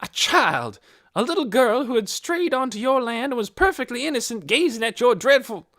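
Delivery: loud, high outraged voice; outraged accusation. A narrator's reading. Missus Beeson accusing Hoyt of threatening a child, outraged. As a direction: pour out the accusation.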